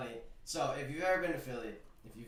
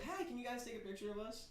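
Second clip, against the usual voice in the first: 1 imitating mom; 9 semitones higher, 7 dB quieter than usual. Mom voiced in a story.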